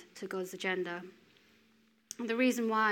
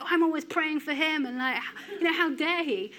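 indignant rant; 7 semitones higher, 5 dB louder than usual. Retold or imitated. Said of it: "And we're like".

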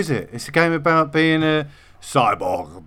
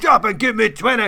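higher drill sergeant bark; 7 semitones higher than usual. Acted. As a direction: bark the order at recruits. Drill sergeant order, joining in.

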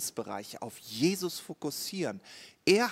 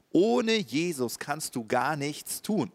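normal talking voice

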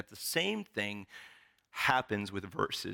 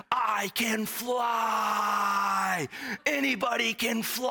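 much higher, stretched-out crazed shout; relentless hyped yell; 12 semitones higher, 3 dB louder, slower than usual. Retold or imitated. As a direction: refuse to back down, yell it out. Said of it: "he will not relent".

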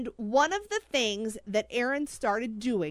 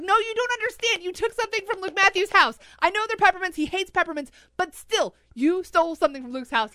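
higher, louder, fast panicked telling-off; frantic retold scolding; 7 semitones higher, 5 dB louder, faster than usual. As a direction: rattle it off in alarm at him. Retelling panicked scolding of husband.

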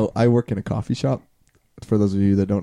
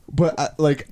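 normal talking voice

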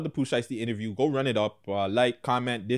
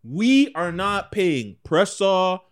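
loud, slow, angry rant; aggressive; 8 semitones higher, 6 dB louder, slower than usual. Real, not acted. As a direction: really lay into them. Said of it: "I had to get a little aggressive".

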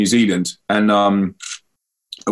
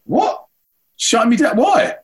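much higher, hammed-up indignant disbelief; mock outrage; 13 semitones higher, slower than usual. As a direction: play the wronged victim for the kids. Playing victim of being shut down.

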